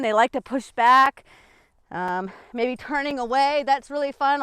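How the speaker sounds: normal talking voice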